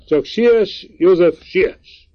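high, loud urging, slowing on the last words; uncle exclaims. A narrator's reading. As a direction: hurry him along with a shout. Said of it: "kiáltott el a bácsi".

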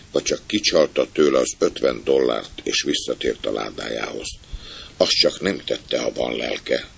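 ordinary delivery, nothing marked

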